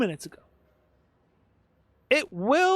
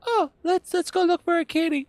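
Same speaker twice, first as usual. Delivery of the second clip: sing-song mockery, much higher; mocking high voice; 8 semitones higher than usual. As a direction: mimic a naive eager kid, sarcastically. Mocking the cat-hunting quest.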